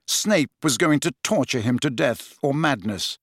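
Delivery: plain reading voice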